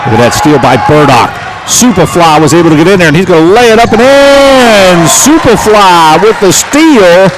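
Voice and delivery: real courtside excitement; excited call. Real, not acted. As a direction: shout the steal and the layup. Steal and fast-break layup call.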